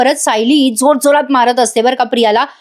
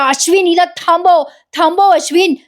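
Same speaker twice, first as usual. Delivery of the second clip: mock shout for help; 6 semitones higher than usual. Priya calls Ashwin to intervene, high mock shout. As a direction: imitate calling out urgently, without real volume. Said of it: "आता मोठ्याने ओरडून म्हणते प्रिया".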